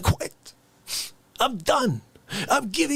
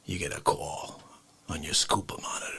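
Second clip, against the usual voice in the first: low, soft whispered setup; 8 dB quieter than usual. Acted. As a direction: murmur it like a secret. Setting up absurd scenario.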